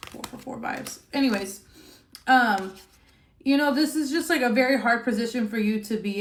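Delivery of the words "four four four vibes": whisper. Acted. Number vibe, said under breath.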